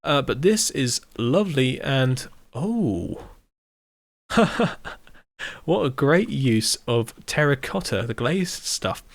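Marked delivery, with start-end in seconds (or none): 4.3-4.9 short high unvoiced laugh; a quick breathless giggle at the build; little burst of laughter; breathy giggle. Real, not acted. Amused reaction to the build.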